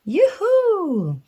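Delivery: high, loud yoo-hoo call. A narrator's reading. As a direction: call out across the hills. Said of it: "cried little cub".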